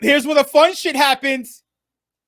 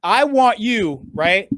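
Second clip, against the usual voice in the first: deliberately slow; slower than usual. Shown as a demonstration. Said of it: "obviously I'm speaking very slowly".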